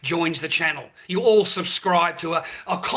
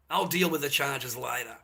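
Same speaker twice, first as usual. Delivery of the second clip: quieter repeat; 4 semitones lower, 6 dB quieter than usual. Real, not acted. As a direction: lower and softer, said to himself. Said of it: "And I'll deal with the charges later".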